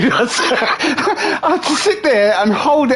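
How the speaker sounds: high, stretched words through laughter; real laughing speech. Real, not acted. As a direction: crack up while telling it, slowly and high. Laughing while retelling his suppressed laugh.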